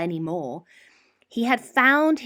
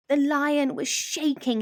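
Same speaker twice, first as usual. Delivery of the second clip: ordinary delivery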